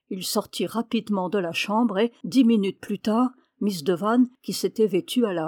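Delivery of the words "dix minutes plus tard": ordinary delivery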